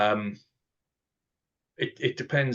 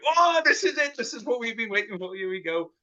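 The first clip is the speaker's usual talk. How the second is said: retold giddy rush, much higher and faster; giddy excitement; 14 semitones higher than usual. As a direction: squeal it like the big news is finally here. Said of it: "got giddy".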